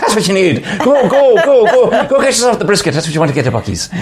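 put-on high, urgent Irish-accented cheerleading; Irish priest. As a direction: hustle them off in a comic Irish accent. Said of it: "And he says".

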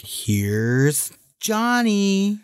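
drawn-out, very slow Here's Johnny. Retold or imitated. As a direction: stretch it out like the madman at the door. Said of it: "nobody can forget".

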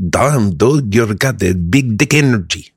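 put-on Russian accent as Igor; impressed Igor with a thick Russian accent. Igor in a Russian spa admires a man's confidence, Russian accent. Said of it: "the Russian accent".

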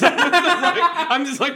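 high, loud, laughing through the words. Real, not acted. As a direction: giggle it out, flustered. Laughing, flustered.

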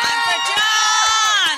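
high, slow cheering yell; cheering shout. Real, not acted. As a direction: cheer loudly for him. Cheering for contestant.

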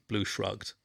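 ordinary delivery, nothing marked

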